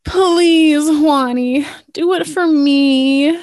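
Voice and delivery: high, slow coaxing plea; pleading. Acted. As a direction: sweet-talk your husband. Wife pleads with husband.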